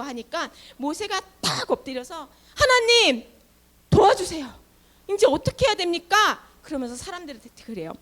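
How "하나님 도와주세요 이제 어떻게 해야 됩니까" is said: higher, louder urgent plea; desperate cry for help. Acted. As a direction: cry out to God in panic. Moses crying out to God.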